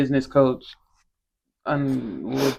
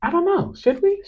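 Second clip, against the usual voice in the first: high coy teasing lilt. Real, not acted. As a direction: play coy, drawn up high.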